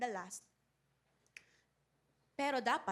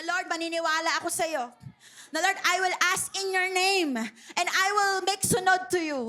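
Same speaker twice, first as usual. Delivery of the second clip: loud, earnest commitment prayer; fervent vow; 4 semitones higher, 10 dB louder than usual. Acted. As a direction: proclaim the vow with full heart. Leading congregation commitment prayer.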